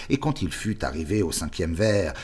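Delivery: ordinary delivery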